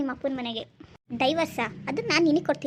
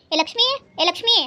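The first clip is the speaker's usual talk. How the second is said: high, loud call across the house; mother-in-law yelling for Lakshmi from inside; 9 semitones higher, 7 dB louder than usual. Acted. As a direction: summon the daughter-in-law sharply.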